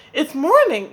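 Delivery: loud, high wake-up call; eager cry over the fire. Acted. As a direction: shout it to rouse the sleeping birds. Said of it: "cried Spider".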